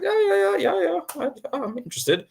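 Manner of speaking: high, rapid eager yeses. Retold or imitated. Scene: colleague offers the Scrum Master chance, eager high yeses. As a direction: jump at the offer. Eager reply to job call.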